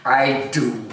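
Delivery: usual speaking voice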